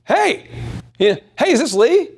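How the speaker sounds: high, casual hello; easy friend-to-friend phone hello; friendly phone greeting. Shown as a demonstration. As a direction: greet him like a buddy. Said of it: "I'm like".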